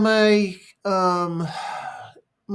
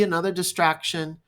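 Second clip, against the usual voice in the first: usual speaking voice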